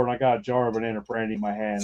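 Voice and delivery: usual speaking voice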